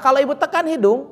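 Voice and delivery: ordinary talk, nothing marked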